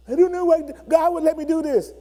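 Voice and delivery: put-on high whining teen complaint; whiny son. Son whining to his father after losing the game. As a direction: sulk like a kid who lost.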